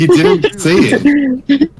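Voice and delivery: high, slow, teasing; drawn-out teasing. Real, not acted. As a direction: stretch it out high and mocking. Teasing about Chia's reaction.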